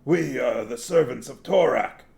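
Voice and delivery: put-on loud, declamatory Murgo accent; old man putting on a guttural Murgo accent. A narrator's reading. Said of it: "in an accent that perfectly matched the gutturals".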